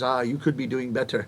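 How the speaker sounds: low, soft grudging verdict; grudging critic. Retold or imitated. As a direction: mutter it like a stern critic. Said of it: "I speak for two hours".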